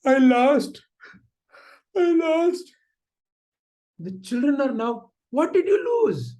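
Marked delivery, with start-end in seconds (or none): 0.0-2.7 loud, high, hammed-up crying; acted sobbing. Mother tells children of her loss, acted loud sobbing. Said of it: "The mother still is sobbing and saying". 5.3-6.3 high-pitched pressing question; urgent questioning. Acted. Children question their sobbing mother, acted urgency.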